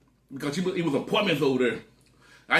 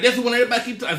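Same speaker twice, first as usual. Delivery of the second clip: excited; 9 semitones higher, 5 dB louder, faster than usual. Retold or imitated. Cousin repeats excitedly.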